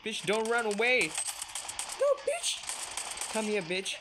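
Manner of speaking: high, loud frantic cries; panicked yelling. Real, not acted. Player panicking as an enemy chases her, frantic yelling. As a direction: yell in panic as you're caught.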